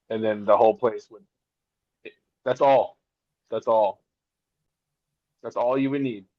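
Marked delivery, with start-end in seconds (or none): none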